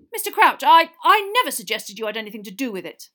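loud stammer, high at first; flustered protest. A narrator's reading. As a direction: hastily deny it to an angry superior. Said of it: "muttered Amos Diggory".

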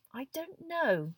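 slow, high, musing answer; pensive. A narrator's reading. Hermione wonders about Moody and Neville, pensive. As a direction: draw it out while wondering. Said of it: "looking pensive".

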